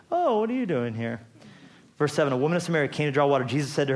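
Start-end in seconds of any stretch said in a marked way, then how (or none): 0.1-1.2 mock innocent surprise, pitched up; acting clueless. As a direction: play dumb like you just stumbled in. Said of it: "Act all, like, clueless".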